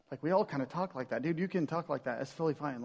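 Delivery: softly brushing it off; casual reassurance. Acted. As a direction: play a friend quietly waving away an apology. Voicing people shrugging off an apology.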